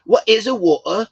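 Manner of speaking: high, loud mock-baffled echo of a Scottish accent; hammed-up Scottish dropped T, pitched high; joking mimicry of accent. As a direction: tease the Scottish pronunciation of water. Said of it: "I would joke back with him".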